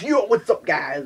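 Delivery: loud greeting. Pretend. Filming in class, pretend loud high vlog greeting. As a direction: burst into class greeting everyone. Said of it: "Just be like in class".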